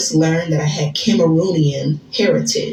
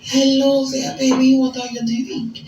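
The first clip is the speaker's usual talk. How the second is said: put-on high breathy-free French-accented flirt, higher pitched; fake French accent; 7 semitones higher than usual. Woman flirting with her date in a fake French accent. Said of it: "I spoke in this French accent".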